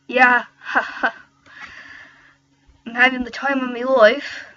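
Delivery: loud, badly faked Irish accent; hearty, clumsy Irish with a spoken ha-ha; worst Irish accent. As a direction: ham up a terrible Irish accent. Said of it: "That's just like the worst Irish accent ever".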